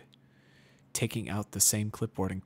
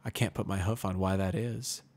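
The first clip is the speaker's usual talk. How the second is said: ordinary delivery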